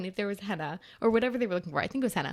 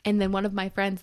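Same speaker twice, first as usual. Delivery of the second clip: usual speaking voice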